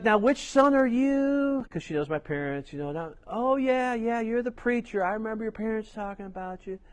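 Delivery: light high-pitched voicing of an older woman on the phone; proprietor's voice.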